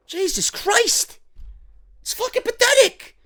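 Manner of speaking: very high, strained exasperation; exasperated disgust. Real, not acted. Reviewer despairs at a pathetic storyline, high-pitched exasperation. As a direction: let the voice leap up in disbelief.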